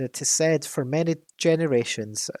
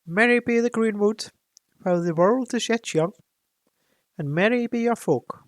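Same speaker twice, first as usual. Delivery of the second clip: put-on higher, lilting unplaced accent blessing; Gandalf's odd accent; 9 semitones higher than usual. Gandalf blessing the Elven King's folk in an unplaced accent. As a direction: bless the greenwood in a vague old-world accent. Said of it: "I don't know what accent that is".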